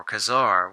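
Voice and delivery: Kazar said as kuh-ZAR; weak first syllable, stress on the ZAR of Kazar; third pronunciation variant. Shown as a demonstration. Collector unsure of the name, trying Kazar as kuh-ZAR. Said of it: "whatever way you pronounce it".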